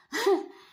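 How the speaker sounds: high, loud burst of laughter. Real, not acted. Laughing at her own remark.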